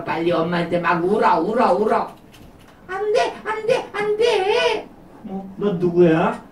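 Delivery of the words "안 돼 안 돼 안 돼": much higher, airy little spirit voice; tiny high voice of a good conscience; high gentle spirit voice. Acted.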